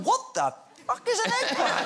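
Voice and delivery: high-pitched, incredulous retold reaction; baffled outburst. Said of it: "And I'm left going".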